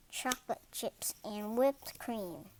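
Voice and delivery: ordinary delivery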